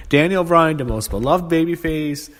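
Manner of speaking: ordinary talk, nothing marked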